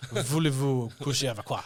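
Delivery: mock French accent as a warm-up. Shown as a demonstration. Explaining the warm-up to the co-host, hammed-up French accent. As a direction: get the French accent out first. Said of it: "how was that French accent?".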